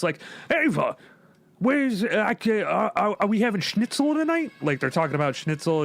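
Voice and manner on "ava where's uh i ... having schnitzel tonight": higher-pitched mock Brooklyn accent. Parody sitcom Hitler asking Ava about schnitzel, Brooklyn accent. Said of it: "they have, like, you know, Brooklyn accents".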